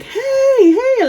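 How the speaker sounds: high, drawn-out mock holler; retold yelling. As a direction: imitate calling across to neighbours. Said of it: "Like, I yell, like, super loud".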